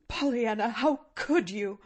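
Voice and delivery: moaning reproach. A narrator's reading. Mortified aunt reproaching her niece, moaning. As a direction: moan at her in mild reproach. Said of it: "moaned the woman".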